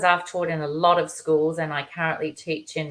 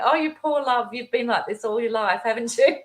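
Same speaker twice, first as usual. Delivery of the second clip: higher, put-on pitying coo; mock pity; 7 semitones higher than usual. Teacher privately pitying a stressed colleague, higher and cooing. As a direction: pity them like a fussing aunt. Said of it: "And you just think".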